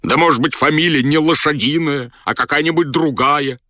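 high-pitched, insistent questioning; pleading general. A narrator's reading. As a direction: press the servant for another answer. Desperate general questions the steward.

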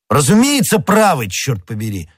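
high, emphatic outburst dropping at the curse; exclaimed agreement. A narrator's reading. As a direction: cry out in hearty agreement. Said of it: "вскричал Д'Артаньян".

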